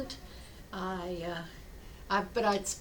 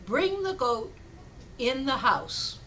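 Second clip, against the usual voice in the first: measured acted command, "goat" and "house" set apart; deliberate instruction; 3 semitones higher, slower than usual. Rabbi telling villager his odd remedy, deliberate instruction. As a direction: hand down the order slowly, with a pause midway.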